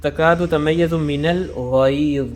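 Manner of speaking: slow, drawn-out Quranic recitation. Shown as a demonstration. Teacher recites the verse for students, slow and drawn out. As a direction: recite it slowly, stretching each sound. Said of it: "اب سب سے پہلے یہ دیکھیں".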